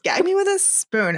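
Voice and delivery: high-pitched mock Valley Girl; Valley Girl quip. Friend joking in mock Valley Girl voice. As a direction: toss it off like a nineties mall girl.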